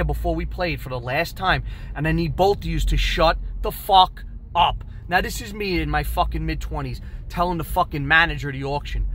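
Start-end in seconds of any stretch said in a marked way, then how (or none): none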